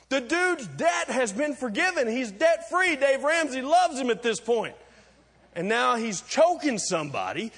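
high, loud, driving preacher's build; rising preaching. Acted. Pastor driving the story home, full preaching force. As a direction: preach it hard to the congregation.

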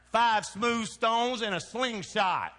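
rising preaching climax. Acted.

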